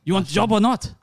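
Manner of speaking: put-on German accent. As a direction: press him in a German accent.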